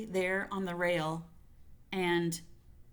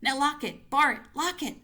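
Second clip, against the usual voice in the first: higher, louder frantic gasps; wretched gasping; 8 semitones higher, 6 dB louder than usual. Acted. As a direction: gasp out orders in terror. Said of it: "she gasped wretchedly".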